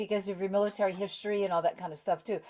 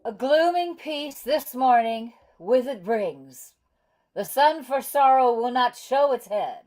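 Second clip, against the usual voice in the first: recited verse; 4 semitones higher, slower than usual. Acted. Actor reads Shakespearean verse aloud.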